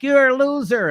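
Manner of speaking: high, loud scornful "loser"; imitating a woman calling her husband a loser; mock woman's insult. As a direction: sneer it at him like an angry wife. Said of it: "I've heard women say in public".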